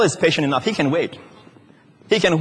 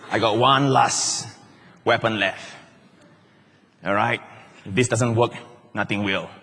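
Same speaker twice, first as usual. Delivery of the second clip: scheming devil; 4 semitones lower than usual. Pretend. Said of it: "Finally, he says".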